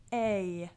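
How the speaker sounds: over-enunciate A as one long vowel; exaggerated letter A. Shown as a demonstration. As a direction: say A loud and drawn out. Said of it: "you have to actually go".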